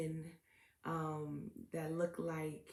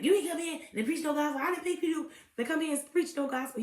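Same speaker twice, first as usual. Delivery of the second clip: higher, louder, fast mock yelling; imitating furious interviewer; 7 semitones higher, 5 dB louder, faster than usual. Host mimics interviewer shouting at guest. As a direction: blast it like he's outraged. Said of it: "He was so mad".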